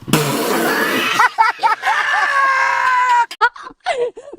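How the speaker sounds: high shrieking laugh; laughing till she nearly cries. Real, not acted. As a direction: burst into a wailing cackle. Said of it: "अपनी हंसी को control करती".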